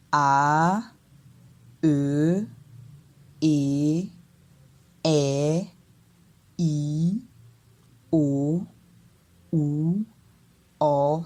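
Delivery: each vowel "A, E, E, I, O, U, O" drawn out, dipping then rising; long isolated vowels with a dip and upward sweep. Shown as a demonstration. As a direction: scoop down and climb on every vowel. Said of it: "on descend et après on monte".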